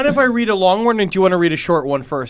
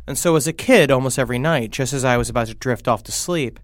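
scary story narration; 6 semitones lower, 6 dB quieter than usual. Acted.

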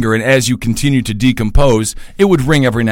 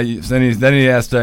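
ordinary talk, nothing marked

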